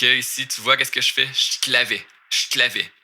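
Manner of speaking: ordinary talk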